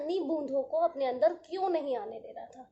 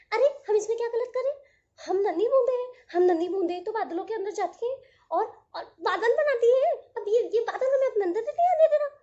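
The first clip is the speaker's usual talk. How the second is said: high, loud, fast droplets close to tears; whimpering little droplets; 6 semitones higher, 7 dB louder, faster than usual. Acted. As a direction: complain to the sun on the verge of crying. Droplets complaining, near tears.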